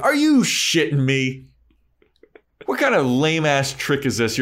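hammed-up outraged disbelief; mock Buffy outraged. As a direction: act shocked and fed up. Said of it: "She's like".

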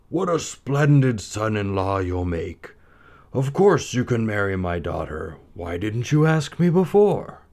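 lightly voiced jovial king; a hint of a hearty sultan. A narrator's reading. The Sultan speaks.

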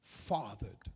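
very soft, low, near-whispered close; solemn, fading almost to a whisper; hushed. Real, not acted. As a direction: drop to a low hush with long pauses.

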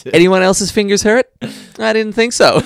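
loud high scolding teacher; stern teacher. Retold or imitated. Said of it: "Happy Gilmore".

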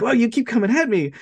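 retelling an excited killer, high and quick; excited imitation. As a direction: gush it, quick and thrilled. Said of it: "he's very excited".

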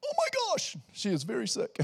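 high-pitched mock gasp of alarm; shocked health worker. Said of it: "she was like".

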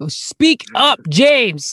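loud, high-pitched order; calling out. Acted. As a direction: yell at the whisperer to speak up. Classmate calls out to whisperer.

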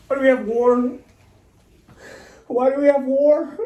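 high, loud howl of a question; yelled. Acted. Repeated question, then yelled.

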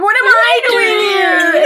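very high mock-panicked outburst; hammed-up high-pitched freak-out; panicked Andy. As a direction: squeal it like someone losing it. Acting Andy's panicked exchange with Sam.

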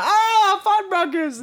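squeaky dreamy sigh; high nostalgic sigh. Pretend. Nostalgic mock voice.

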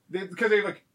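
ordinary talk

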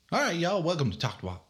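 high "All right" dropping into the welcome; rally the listeners with a quick y'all greeting; folksy intro. Shown as a demonstration. Host showing a third way to open the show, folksy. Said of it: "I already got three out of the way".

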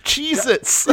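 high, loud shrieking of a snack name; mock panicked yell. Moses shrieking in alarm at God. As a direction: screech it like a startled Moses.

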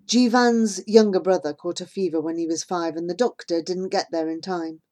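usual speaking voice

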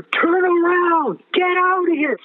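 very high, drawn-out cry of warning; high wailing warning, stretched out slowly; retold screaming. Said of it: "I hear screaming".

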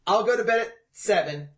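high, loud breezy overconfidence; mocking drunk logic. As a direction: mimic a careless plan, upbeat. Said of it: "the logic that you were like".

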